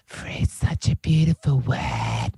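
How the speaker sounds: slow, breathy voice-over. Retold or imitated.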